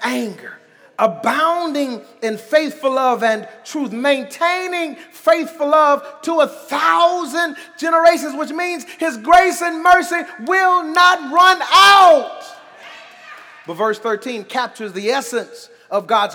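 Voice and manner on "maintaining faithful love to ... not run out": rising preacher's cry; preaching. Acted. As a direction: lift it to a shout of praise. Preaching build.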